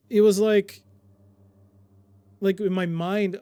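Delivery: loud excited outburst; excited burst. Real, not acted. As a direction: blurt it out, thrilled. Excited retelling of puzzle logic.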